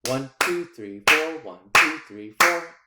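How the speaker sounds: quick, loud counted beats. Shown as a demonstration. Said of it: "if I go a little bit faster".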